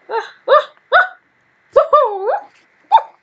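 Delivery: high, loud excited squeals; excited yelps. Real, not acted. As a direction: burst out yelping with glee. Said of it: "I'm acting all weird".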